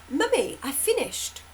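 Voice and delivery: put-on high little child saying she's finished; small child voice. Child telling mummy she's finished, lightly high voice.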